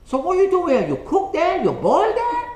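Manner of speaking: put-on loud, high caricature of a Chinese accent; incredulous Chinese-accented questions, shooting up in pitch; mock Chinese accent. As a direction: badger him in put-on Chinese-accented English. Playing the baffled Chinese customer.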